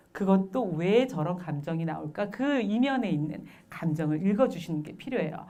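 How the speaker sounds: ordinary talk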